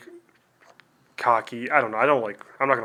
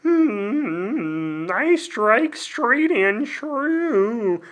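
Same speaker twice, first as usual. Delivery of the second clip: noble elf lord; 10 semitones higher, much slower than usual. Acted. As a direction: declaim each word slowly like a lofty elf hero. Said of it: "the elf that has to fight ... with such a".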